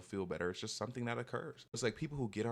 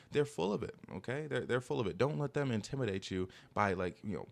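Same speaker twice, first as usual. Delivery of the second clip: normal talking voice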